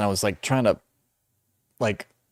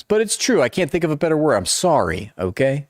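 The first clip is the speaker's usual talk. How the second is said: high-pitched mock protest; playfully defensive; 7 semitones higher than usual. Real, not acted. Streamer defending saying appreciate five times, high defensive protest. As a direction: protest in a raised, pleading pitch.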